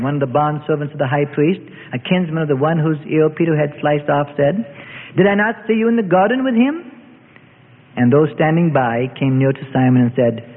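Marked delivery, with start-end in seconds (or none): 5.2-6.8 higher, challenging question; accusing. A narrator's reading. Kinsman questioning Peter about the garden, accusing. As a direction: challenge him with rising pitch. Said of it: "decided to press their suspicions".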